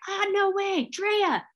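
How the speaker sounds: best friend Amy bragging to the guides, squealing high; stoked friend hyping. Retold or imitated. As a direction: hype her up like a proud best friend. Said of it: "she was just like, you know, so stoked".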